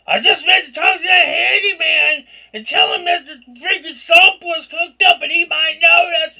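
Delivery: high, loud rushed excuse; a loud nervous babble; loud flustered explaining. Retold or imitated. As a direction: blurt it out, embarrassed and high. Retelling her awkward explanation.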